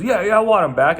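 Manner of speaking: imitated eager demand, high and loud; mock an over-eager yes; insistent clubgoer. Mimics man's insistent answer.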